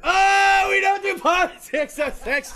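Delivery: high, loud, slow protest; hammed-up indignant yell; mock outraged shout. Mock protest against cohost's joke.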